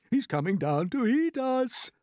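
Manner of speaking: high, slow, drawn-out wail. A narrator's reading. Old grasshopper warns that the Cloud-Man will eat them, wailing. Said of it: "wailed the old green grasshopper".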